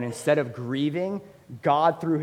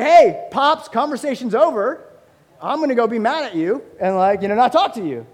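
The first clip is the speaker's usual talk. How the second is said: put-on high, loud, sassy child voice; defiant little kid; 9 semitones higher, 10 dB louder than usual. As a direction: play the four-year-old slamming the conversation shut. Child voiced locking dad out.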